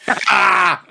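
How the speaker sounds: loud, high "Ah!" bursting out of a laugh; high triumphant yell; shouted laugh. Real, not acted. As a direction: crow it out, laughing. Mock outcry as host admits defeat.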